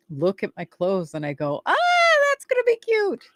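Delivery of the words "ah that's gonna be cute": very high, gushing self-talk; delighted squeal. Acted. Co-host admiring her clothes in the morning, hammed-up delight. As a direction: squeal playfully over your own outfit.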